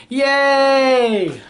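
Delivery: high, long drawn-out cheer; happy elephant cheer. Acted. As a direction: celebrate with one stretched whoop. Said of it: "Elephant is so happy".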